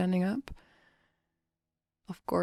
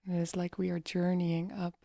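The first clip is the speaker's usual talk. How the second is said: guided meditation. Acted. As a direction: guide them gently into relaxation, leaving long pauses.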